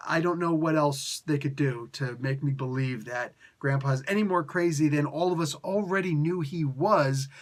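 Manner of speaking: ordinary talk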